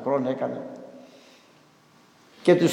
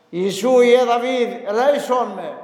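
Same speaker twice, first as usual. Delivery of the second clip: higher, louder plea for mercy; louder retold cry; 7 semitones higher, 3 dB louder than usual. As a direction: call out harder than the first time. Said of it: "φώναζε πιο δυνατά".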